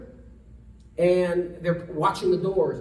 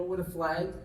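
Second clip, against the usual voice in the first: normal talking voice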